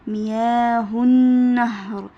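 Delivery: مياه النهر very slow and loud with a clear ه; slow emphatic demo. Shown as a demonstration. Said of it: "بتنطق هاء زي ما هي".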